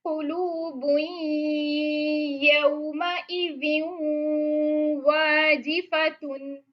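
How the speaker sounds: slow, drawn-out tajweed recitation; Quranic recitation. Shown as a demonstration. Teacher reciting a verse to students, slow recitation. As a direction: chant it slowly like Quran recitation.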